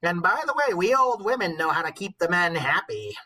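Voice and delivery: high, loud knowing crone voice; sly old woman. Acted. The old wife promises to please him, high and loud. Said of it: "she's like".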